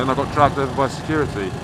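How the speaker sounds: normal talking voice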